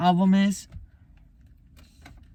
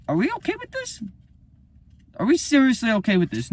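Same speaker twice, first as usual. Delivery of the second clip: incredulous; 10 semitones higher, faster than usual. Real, not acted. Outraged rhetorical question.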